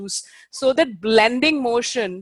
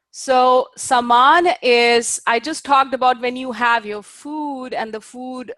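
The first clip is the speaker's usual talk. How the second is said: normal talking voice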